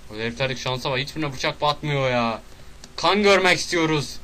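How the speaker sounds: usual speaking voice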